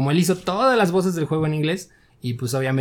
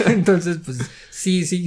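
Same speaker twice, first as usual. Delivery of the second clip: laughing; 4 semitones higher, slower than usual. Real, not acted. Laughing as he picks the story back up. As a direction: still laughing through the word.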